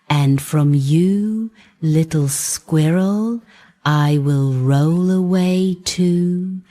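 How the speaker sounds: a proud little bun chanting his rhyme, slowing at the end; Kolobok reciting his poem. Acted.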